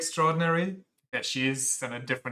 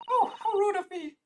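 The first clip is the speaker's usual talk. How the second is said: very high, thin character voice; squeaky high voice; faster than usual. Acted. Streamer voicing a game character, very high. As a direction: push the voice way up into a squeak. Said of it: "this voice is either kidding me and too annoying".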